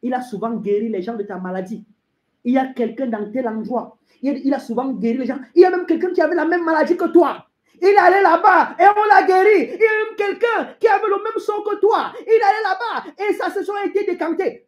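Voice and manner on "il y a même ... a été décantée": high, loud, insistent rush; urgent preaching. Acted. Preacher voicing people urging the sick elsewhere, urgent. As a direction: pile up the tempting reports at a high pitch. Preaches at full force, acting tempters.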